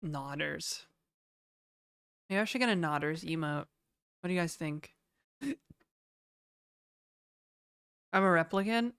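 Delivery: talking through a blocked nose; stuffed up, nose blocked. Real, not acted. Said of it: "I don't know why I can't breathe through my nose right now".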